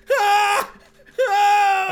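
high, loud shriek of terror; terrified scream. Acted. [name] screams in terror at the ghost. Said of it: "a full hour of screaming".

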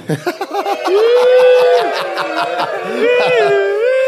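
high rising siren wail. Pretend. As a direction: wail like a siren through laughter. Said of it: "make a siren sound".

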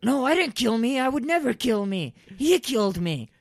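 high, loud panicked denial; frantic protest. Acted. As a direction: protest the arrest desperately. Protesting arrest.

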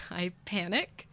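plain reading voice

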